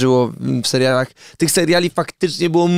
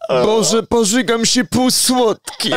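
disgusted; 8 semitones higher, much slower than usual. Acted. Disgusted outburst at sweet wine.

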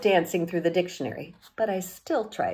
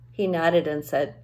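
usual speaking voice

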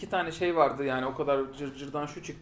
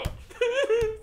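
laugh. Real, not acted. Laughing at own impression.

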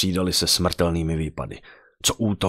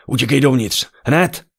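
husband yells high and loud; urgent shout; 8 semitones higher, 7 dB louder than usual. A narrator's reading. Husband grabbing his wife by the shoulders, urgent shout. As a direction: shout the order to run, no time to lose. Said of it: "a zařval".

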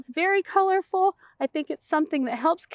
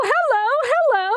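excited hello; 9 semitones higher, 4 dB louder than usual. Acted. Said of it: "hello, spotty fish".

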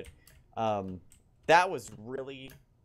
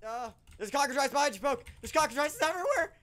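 panicked; 8 semitones higher, faster than usual. Real, not acted. Mobs attacking.

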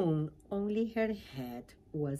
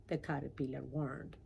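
ordinary delivery, nothing marked

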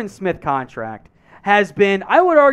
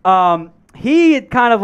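normal talking voice

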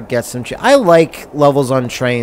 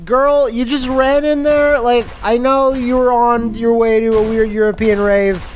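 mock sassy girlfriend; 7 semitones higher than usual. Talking to game character, high voice.

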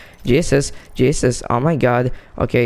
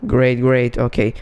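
ordinary delivery

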